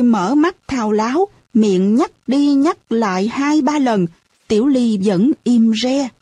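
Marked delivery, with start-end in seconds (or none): none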